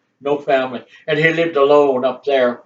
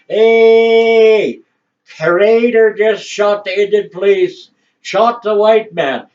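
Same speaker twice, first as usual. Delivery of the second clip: high, loud calling out across the valley; camp crier's call; 6 semitones higher, 4 dB louder, slower than usual. Retold or imitated. Camp crier shouting the news down the valley. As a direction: call it from a hilltop so the next camp hears. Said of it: "We had camp criers".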